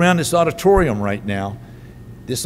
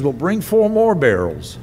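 raised-pitch command with a falling close; lightly acted call for more; retold called-out order; 4 semitones higher than usual. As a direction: call it out to the helpers, half-acted. Elijah's repeated order retold as a call.

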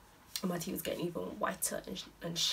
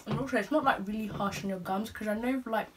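talking with strip over teeth. Real, not acted. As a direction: lips apart over coated teeth. Seen 1: Presses dark strip onto teeth; teeth stay dark while talking.

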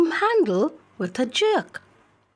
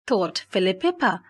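ordinary delivery

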